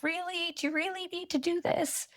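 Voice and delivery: high, incredulous mock of the doubters; doubtful scoff. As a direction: mimic a sceptical relative. Doubting friends and family quoted.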